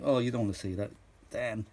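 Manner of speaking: ordinary delivery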